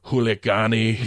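put-on Arnold accent. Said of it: "Arnold just ... goes".

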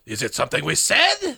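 lightly squeaky French comic sidekick; silly put-on French voice; mock French accent. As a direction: imitate a hacky sidekick with a touch of French accent. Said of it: "doing a French accent, having inhaled helium".

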